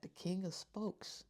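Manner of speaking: normal talking voice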